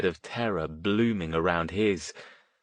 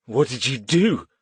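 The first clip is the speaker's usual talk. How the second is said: scared; 4 semitones higher, 4 dB louder than usual. A narrator's reading. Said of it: "He sounded scared".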